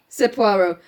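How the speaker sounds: plain reading voice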